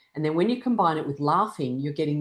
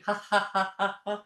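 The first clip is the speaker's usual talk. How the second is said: short deliberate ha-ha burst, slightly higher; demonstrated exercise laugh; shaking laugh; 5 semitones higher than usual. Shown as a demonstration. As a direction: laugh on purpose while shaking. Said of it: "a good shake while laughing".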